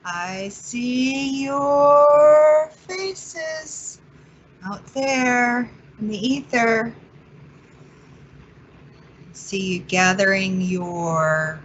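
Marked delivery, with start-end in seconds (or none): 0.0-6.9 high, drawn-out singsong hello; playful singsong greeting. Pretend. Host hailing faces out there in the ether, playful singsong. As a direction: call out sweetly high, stretching the vowels. Host hails unseen online audience.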